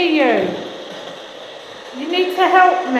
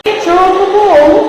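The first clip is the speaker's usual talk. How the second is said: loud; 9 dB louder than usual. Real, not acted. Repeating question to the spirit box.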